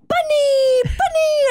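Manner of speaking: put-on high, delighted little-kid squeal; high toddler voice, laughing. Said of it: "and Jimmy's like".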